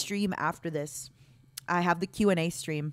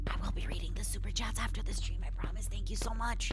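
hushed, quick whisper; whispered aside; 7 dB quieter than usual. Real, not acted. Streamer promises chat to read super chats later, whispered. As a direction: whisper a soft, hurried promise.

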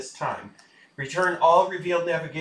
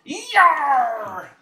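pirate yell; 11 semitones higher, 8 dB louder than usual. Acted. Pirate captain roleplay cheer.

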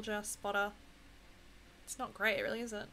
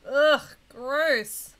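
annoyed ugh; 4 semitones higher, 9 dB louder than usual. Real, not acted. Reacting to game voice line.

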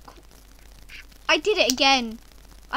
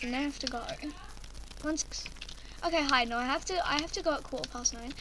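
ordinary talk, nothing marked

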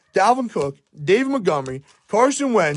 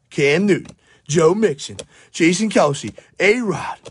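ordinary delivery

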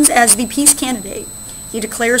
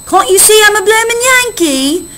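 much higher, louder cheeky British voice; louder, higher mock British sailor pretending to be a Yankee; 10 semitones higher, 6 dB louder than usual. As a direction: bluff it out like a cocky Brit. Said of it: "the British guy says".

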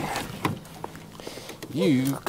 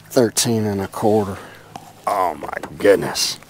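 ordinary delivery

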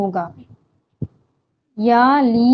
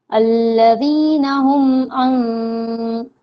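slow, drawn-out Quranic chant; Quran recitation; much slower than usual. Shown as a demonstration. Teacher reciting the verse for students, slow chant. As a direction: recite each word slowly, holding the long vowels.

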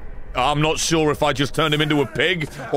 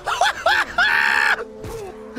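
shrieking laugh, much higher; high cackling laugh. Real, not acted. Streamer losing it over the shush, high shrieking laugh. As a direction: crack up in a high whooping cackle.

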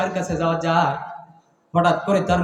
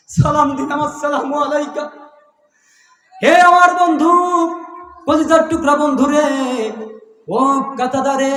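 high chanted appeal to a friend; long-held, pleading high cry; impassioned calling out; 10 semitones higher, 7 dB louder than usual. Acted. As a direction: call to the beloved friend in a raised preacher's chant. Voices a plea to old friend.

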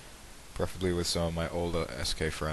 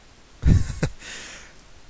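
laugh; 9 dB louder than usual. Real, not acted. Laugh at video start.

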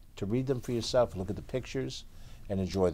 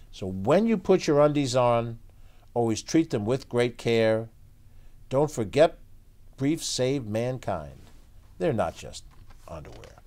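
put-on lively read-aloud for kids; story narration; 4 semitones higher, slower than usual. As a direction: tell it like a bedtime picture book. Reads picture book aloud.

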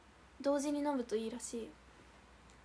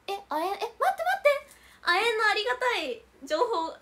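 loud, high burst of excitement; eager squeal at a helpful tip; excited; 5 semitones higher, 8 dB louder than usual. Real, not acted. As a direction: jump at the good news. Excited reaction to chat tip.

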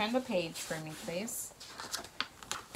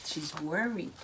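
usual speaking voice

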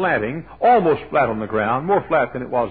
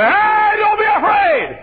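very high, loud, drawn-out yell; mock panicked shout; 8 dB louder, slower than usual. Shown as a demonstration. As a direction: holler it like the wrong way to reassure. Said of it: "He didn't say".

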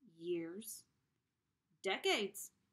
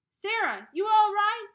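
much higher, loud call; calling from downstairs; 12 semitones higher, 17 dB louder than usual. A narrator's reading. Father calls up to Sarah from downstairs. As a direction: call up the stairs to your daughter. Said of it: "A call from downstairs".

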